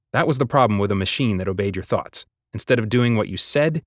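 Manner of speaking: usual speaking voice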